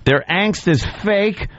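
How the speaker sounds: mock dramatic reading. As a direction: declaim it with heavy emphasis and pauses. Reads the writer's piece aloud.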